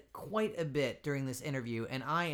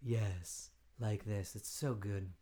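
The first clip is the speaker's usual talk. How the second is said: lower, imitating a rich, melodic voice; mock deep voice; 7 semitones lower, 5 dB quieter, slower than usual. Host mimics guest's deep voice, lower, imitating. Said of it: "deep, rich, melodic voice".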